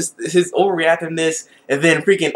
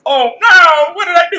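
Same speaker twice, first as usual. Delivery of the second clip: much higher, louder panicked cry; mock dismay; 11 semitones higher, 6 dB louder than usual. Imitating the main character after he falls, high and loud. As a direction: yelp it like a boy in a panic. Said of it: "And he was like".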